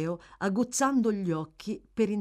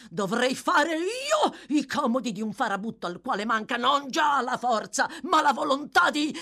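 raging rant; 9 semitones higher, 4 dB louder than usual. A narrator's reading.

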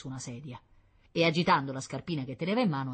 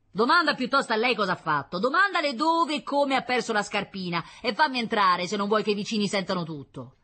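loud, higher, insistent argument; heated demand; 8 semitones higher, 7 dB louder than usual. A narrator's reading. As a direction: press her angrily through the door. Husband argues to be let in. (richiesta accesa e insistente)

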